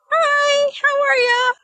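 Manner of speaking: high, drawn-out mock-cheerful greeting; forced cheeriness. In-laws answering the honeymoon call, strained fake cheer. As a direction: stretch it out in a high, put-on phone voice.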